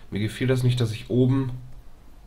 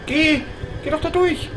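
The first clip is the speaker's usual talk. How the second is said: much higher, pleading push; exasperated urging. Real, not acted. Player urging a companion onward, high exasperated. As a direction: go through already, come on.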